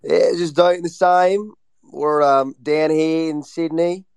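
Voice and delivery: put-on Australian accent. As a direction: lay the Australian accent on thick. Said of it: "You're so good at it".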